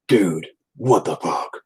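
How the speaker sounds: slow, heavy disbelief; dumbfounded. Retold or imitated. Buddy cannot believe the casual chat, slowly dumbfounded. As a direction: draw each word out, stunned.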